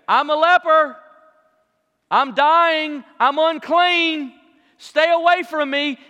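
loud, high call across a distance; loud proclaiming. Acted. Leper warning others to keep their distance, loud proclaiming. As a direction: call it out so people keep away. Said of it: "you had to say".

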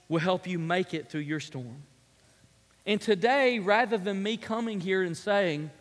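ordinary delivery

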